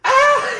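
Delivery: loud, very high scream; retold horrified scream. Friend seeing the bloodied face when lights come on, screaming. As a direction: scream at the sight of his face. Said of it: "He starts screaming".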